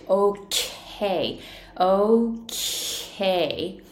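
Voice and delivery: OK with a drawn-out, airy K; 長く残るK. Shown as a demonstration. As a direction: hold the breath noise of the K in OK. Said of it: "こういう風に自然とこ". (息が長く残るKのOK)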